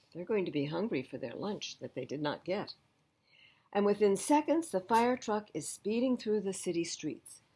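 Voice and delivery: ordinary delivery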